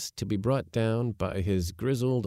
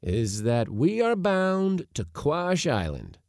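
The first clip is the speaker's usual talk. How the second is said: higher, slow, resolute announcement; firm; 7 semitones higher, slower than usual. A narrator's reading. Uncle settling where they are bound, firm. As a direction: state the destination slowly and firmly. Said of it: "said my uncle firmly".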